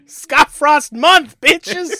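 slow, loud mock cheer; triumphant shout. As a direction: bellow it like a victory chant.

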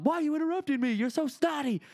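very high, shrill mock squabble; mock yelling mom. Mom and son fighting while on hold, high mock yelling. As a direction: squawk it like a bickering mother. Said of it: "we're just yelling at each other".

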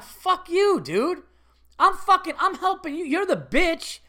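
high, loud retold indignation; indignant inner outburst. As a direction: lash back as if betrayed, voice shooting up. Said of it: "in my mind, like".

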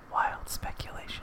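hushed, whispered catchphrase. Pretend. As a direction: whisper it like a mysterious game cue.

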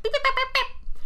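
very high, loud beeps; imitated beeping noises. Host imitating the boxing clown, high loud beeping. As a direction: squeak out sharp little clown beeps. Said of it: "beeping noises that's like".

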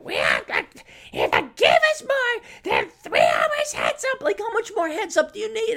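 hammed-up high nag; mock shrill lecture. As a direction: screech it like a nagging friend. Mocking friends lecturing him.

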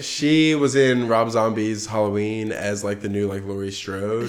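normal talking voice

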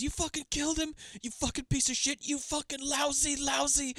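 high-pitched mock outrage; hammed-up anguish. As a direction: wail the accusation like an overwrought actress. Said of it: "Oscar clip".

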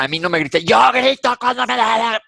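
high, loud retold shout at father; shouting back. Yelling back at her father.

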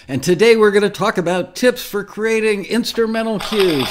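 loud, high host hype; hyped announcement. Acted. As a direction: sell it like a big reveal.

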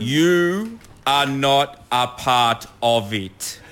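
very slow, word-by-word emphasis; emphatic. Real, not acted. Lecturing attention-seeking cricket fans, slow and emphatic. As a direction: spell it out slowly for them.

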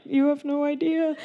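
high, strained mock-tearful voice. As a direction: wail it lightly through pretend tears. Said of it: "And I just start crying".

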